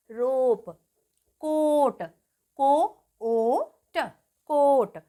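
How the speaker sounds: "कोट" with a long, held ओ; long O demo. Shown as a demonstration. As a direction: sound out "रोप" as रो, ओ, प, vowel stretched. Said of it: "alphabet का पूरा".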